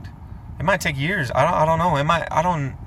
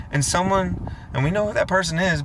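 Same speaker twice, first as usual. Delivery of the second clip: normal talking voice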